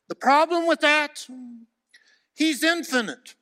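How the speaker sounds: loud, high revival preaching; fiery pulpit cry; preaching at full force. Acted. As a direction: thunder it out to the congregation.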